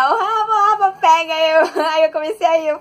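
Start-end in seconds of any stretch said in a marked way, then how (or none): 0.1-1.3 high, loud quoted yell; imitando grito. Retold or imitated. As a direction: mimic the street alarm shout. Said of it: "que tava gritando aí". (imitando grito agudo na rua)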